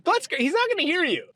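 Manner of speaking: high-pitched, insistent urging; urgent friend. Retold or imitated. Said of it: "my friend was like".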